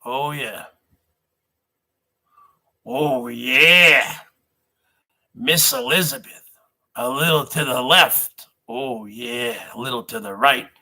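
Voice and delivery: Oh yeah, in a low put-on wrestler voice. Wrestler-style voice to Miss Elizabeth.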